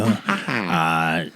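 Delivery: usual speaking voice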